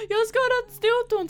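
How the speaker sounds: high, loud tearful mock wail; imitating her crying self; mock whimper. Girl telling her friend about the toe, mock wail. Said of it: "jag bara".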